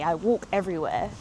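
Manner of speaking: normal talking voice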